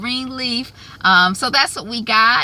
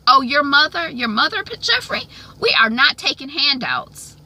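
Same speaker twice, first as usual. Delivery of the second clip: imitated mother, higher-pitched scolding; overbearing mother; 7 semitones higher, 3 dB louder than usual. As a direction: play a pushy mom laying into them. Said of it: "words that have come from her mouth".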